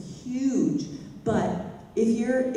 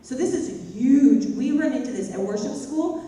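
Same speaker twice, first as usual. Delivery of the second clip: ordinary delivery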